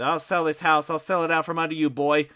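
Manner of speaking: high, loud screaming threat; mother screams at her son she'll sell the house. Acted. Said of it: "Miss Riley screamed".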